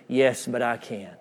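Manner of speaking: low, soft, assured reply; inner voice of Jesus. Retold or imitated. Preacher voicing Jesus's answer in his heart, quiet assurance. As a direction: answer gently, as if heard only inwardly. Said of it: "I heard the voice of Jesus, not audibly, but in my heart".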